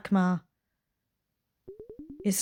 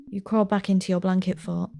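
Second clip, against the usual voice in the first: normal talking voice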